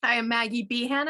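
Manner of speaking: usual speaking voice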